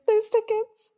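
a sudden jump up high on "there's tickets"; excited squeal. Retold or imitated. Fan spotting cheap tickets, pitch leaps up in excitement. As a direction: squeak it out like you can't believe your luck.

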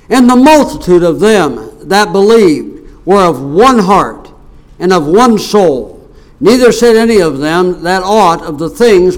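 declaimed Bible reading with pauses between phrases; Scripture reading. Real, not acted. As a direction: read the verses out forcefully, phrase by phrase. Reads Scripture aloud.

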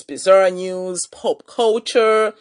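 usual speaking voice

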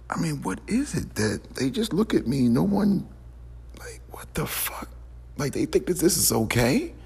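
high-pitched, incredulous complaint; real disbelief. Real, not acted. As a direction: voice climbs high in baffled outrage. Upset retelling, onlookers did nothing.

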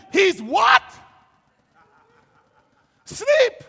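high, loud, incredulous reaction; stunned outburst, pitched high; shouted disbelief. Acted. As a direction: cry out as if you can't believe it. Disciples' disbelief at sleeping Jesus.

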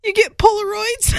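bursting out the punchline, laughing. Real, not acted. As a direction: shout it, cracking up.

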